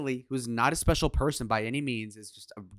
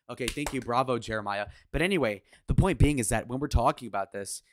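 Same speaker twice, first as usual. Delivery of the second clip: normal talking voice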